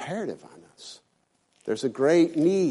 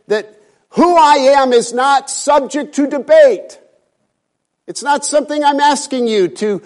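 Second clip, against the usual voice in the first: booming sermon voice declaring God's identity; full-force preaching; 8 semitones higher, 9 dB louder than usual. Acted. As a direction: proclaim God's name with full power.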